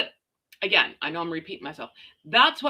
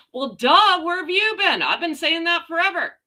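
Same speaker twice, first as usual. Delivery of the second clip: high, loud told-you-so; loud high well duh, told you so; exasperated duh; 8 semitones higher, 5 dB louder than usual. Real, not acted. Mocking people who caught on late.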